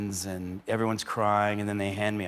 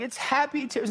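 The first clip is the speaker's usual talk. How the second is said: fiancée's voice. Retold or imitated. Diane comforting her crying daughter, high and gentle. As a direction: gently explain it to the child in a lifted voice. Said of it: "And then Diane's going".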